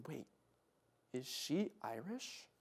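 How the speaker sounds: very soft, slow question; hushed aside. Retold or imitated. Friend turns to him during the movie, hushed question. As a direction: imitate a friend quietly checking in a cinema.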